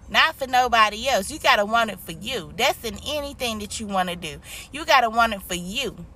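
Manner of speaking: ordinary talk, nothing marked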